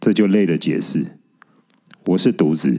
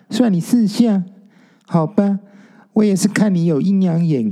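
arrogant; 9 semitones higher than usual. Acted. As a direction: lord it over him, high and smug. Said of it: "用很嚣张的语气说". (高声嚣张地摆架子)

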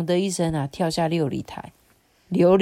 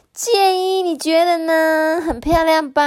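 fish for a compliment in a high cartoon voice; preening talking rice cooker; 11 semitones higher, 6 dB louder than usual. A narrator's reading. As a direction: vain little gadget, pitched high.

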